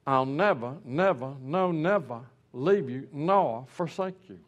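"never, never, no, never" spaced and weighted; stress on each never. Shown as a demonstration. Preacher showing the verse's real emphasis, slow and weighted. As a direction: lean on every "never" slowly. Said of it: "But it's actually".

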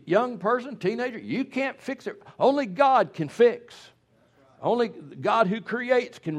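loud, high revival sermon voice; preaching at full force. Real, not acted. Preacher exhorting parents and teenagers, loud and high. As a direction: preach it hard to the congregation.

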